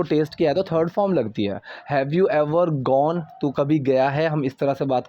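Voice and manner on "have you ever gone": slow model sentence. Shown as a demonstration. Teacher models slow English line.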